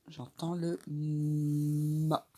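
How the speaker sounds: very slow J'entends le ma; drawn-out model answer. Shown as a demonstration. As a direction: answer slowly like the children should. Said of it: "Qu'est-ce que j'entends dans".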